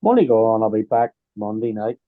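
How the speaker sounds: ordinary delivery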